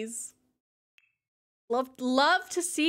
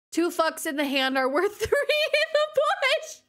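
higher, reading through laughter; higher amused read; laughing; 9 semitones higher than usual. Real, not acted. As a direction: crack up mid-sentence. Reads joke, laughing.

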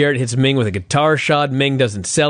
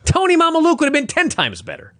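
much higher, incredulous outburst; ranting about who got the gimmick, higher and incredulous; exasperated high rant; 11 semitones higher than usual. Real, not acted. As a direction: vent disbelief at the booking.

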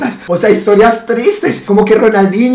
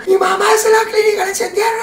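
grito agudo fingido y rápido; falsa emergencia; 13 semitones higher, faster than usual. Pretend. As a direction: grita que la mamá está en la clínica. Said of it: "hace show mediático". (pánico fingido, agudo y rápido)

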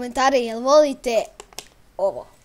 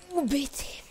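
ordinary talk, nothing marked

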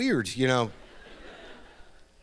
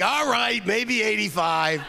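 put-on high, slow old comic conceding; Mel Brooks; 11 semitones higher, slower than usual. Comedian agreeing over the phone, high and slow. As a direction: savour the number like an old showman. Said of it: "He's like".

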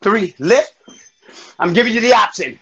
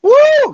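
very high, loud, held "woo"; big workout whoop; 5 dB louder than usual. Real, not acted. Instructor during the last rep, big whoop.

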